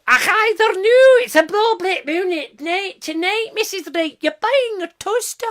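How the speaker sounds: hammed-up Edinburgh Scots voice; mock Scottish accent. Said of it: "We all talk like this".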